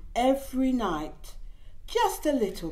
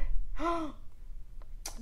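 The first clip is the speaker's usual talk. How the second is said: gasp; 7 semitones higher, 3 dB quieter than usual. Acted. Reading a baby book aloud.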